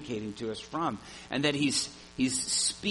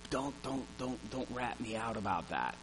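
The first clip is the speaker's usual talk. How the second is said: hushed plea; 3 semitones lower, 10 dB quieter than usual. Retold or imitated. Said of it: "privately and quietly".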